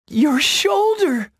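high, loud, drawn-out exclamation; alarmed cry. A narrator's reading. Feline seeing her friend's wounded shoulder, alarmed cry. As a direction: cry out slowly in a high fawn voice. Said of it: "Feline cried".

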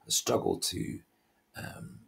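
ordinary talk

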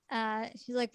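usual speaking voice

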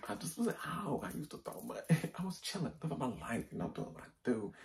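high, loud talk through laughter; real laughter bubbling through the words; laughing. Real, not acted. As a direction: crack up while reminiscing. Laughing about her party days.

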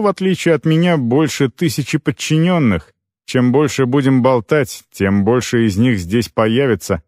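plain reading voice

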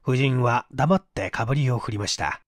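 ordinary delivery

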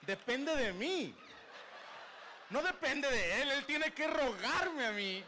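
slow, high, smug and laughing; mock empowered. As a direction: savour the power, slowly. Se siente empoderado, lento. (empoderado burlón, lento y agudo)

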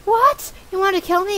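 put-on high-pitched kid disbelief; shocked son voice. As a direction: squeal the question at Dad. Son shocked by dad's reply.

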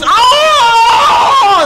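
long, loud, very high howl; elephant's pained scream. Acted. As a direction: let out a huge hammed-up shriek of pain. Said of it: "She goes".

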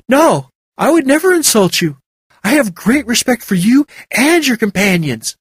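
Kalina voiced. A narrator's reading.

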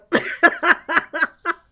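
loud high laugh. Real, not acted. Streamer laughing at a customer dressed like an agent. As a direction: crack up.

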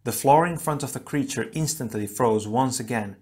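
ordinary delivery, nothing marked

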